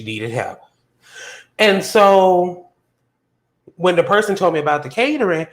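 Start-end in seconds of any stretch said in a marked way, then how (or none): none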